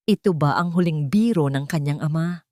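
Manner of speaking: ordinary talk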